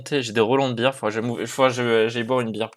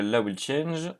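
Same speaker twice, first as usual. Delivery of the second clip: French-accented English; 7 dB quieter, slower than usual. Real, not acted. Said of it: "mon magnifique accent anglais".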